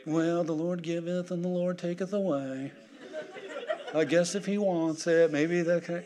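soft, passive believer voice; mock resignation. Preacher mimics believers giving up to the Lord, mock resignation. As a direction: put on a meek, resigned churchgoer.

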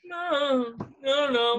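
high, whimpered pleading; hammed-up helpless wail; mock distress. Threatened boyfriend reacting, high mock-distressed whimper. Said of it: "And he's just like".

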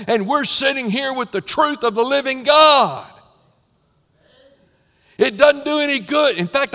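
loud, rising preacher voice building urgency; preaching. Acted. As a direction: press the warning on the congregation. Preaching at full force.

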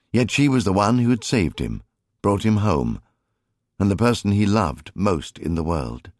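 ordinary delivery

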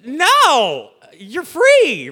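high, loud, stretched-out protest; hammed-up astonished shout; mock incredulous. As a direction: exclaim it in exaggerated disbelief. Acts Moses' shocked reply to complaints.

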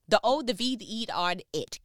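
each letter of "over" punched apart; spelled out. Shown as a demonstration. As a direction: spell it out to drive it home. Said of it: "spells out "over it" for emphasis".

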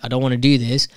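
normal talking voice

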